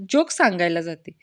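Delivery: ordinary talk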